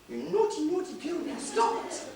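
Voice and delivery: high, mock-stern telling off, fighting back a laugh; mock scolding of a baby. Father mock-scolding his newborn daughter, high and stifling a laugh. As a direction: scold the tiny baby in a squeaky pretend-stern voice. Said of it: "Trying not to laugh".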